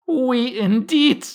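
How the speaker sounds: very high, loud, drawn-out outraged mouse; indignant cry. A narrator's reading. The trembling mouse rebukes Alice, crying indignantly. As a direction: squeal it out, stretched with scorn. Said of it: "cried the mouse".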